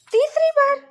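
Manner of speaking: high, loud shocked cry; shocked disbelief. Acted. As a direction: blurt it out in stunned disbelief. Daughter shocked by pregnancy news.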